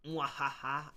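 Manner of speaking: hammed-up evil laugh; villain cackle. Villain laugh before role.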